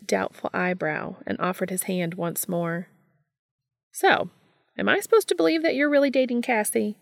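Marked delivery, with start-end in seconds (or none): 3.9-7.0 high, quick blunt question. Acted. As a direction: fire it off bluntly and teasing. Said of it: "Gabriel asked bluntly".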